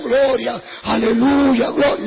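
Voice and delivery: shouted gloria aleluya; loud church praise. Retold or imitated. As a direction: call it out like a fervent church crowd.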